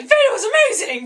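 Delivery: high, very loud mock-enthusiastic shout; over-the-top excitement. Vlogger playing future self who forgot the effort, wildly excited. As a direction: gush it at full volume, hammed-up.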